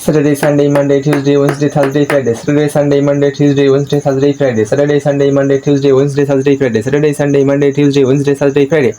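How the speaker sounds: day names chained in an even rhythm without pauses; continuous practice drill. Shown as a demonstration. Teacher practising the days in one go, continuous drill. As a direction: rattle off the week on a level tune, round and round. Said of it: "আমি একটু চর্চা করি একবারে".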